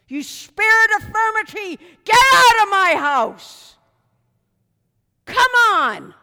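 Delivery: full-force shouted rebuke, high and loud; fiery preacher casting out spirits at a yell; commanding rebuke. Real, not acted. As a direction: shout down evil spirits with authority. Preacher commands evil spirits to leave.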